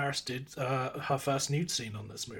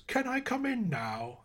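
put-on [name]'s English accent; 9 semitones higher, 3 dB quieter than usual. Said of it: "South Park is the one where she just goes".

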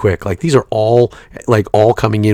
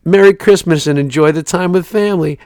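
mock cheery; 7 semitones higher than usual. Mocking parents' holiday texts.

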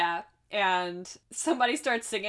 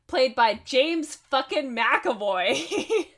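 slow, loud, gleeful reveal breaking into a laugh; delighted emphasis; 4 semitones higher, 6 dB louder, much slower than usual. Real, not acted. Podcaster revealing a favourite surprise cameo to the co-host, delighted. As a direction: draw it out loud and high, laughing at the end.

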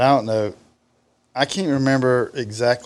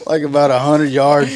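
ordinary delivery

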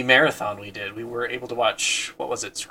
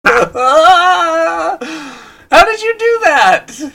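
high, loud, amazed outcry; amazed squeal; 11 dB louder, much slower than usual. Real, not acted. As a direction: squeal in disbelief. Amazed reaction to the voice.

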